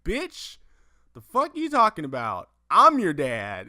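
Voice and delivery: high, loud incredulous scoff; mock Vader. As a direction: bark back like an offended villain. Voicing Vader mocking.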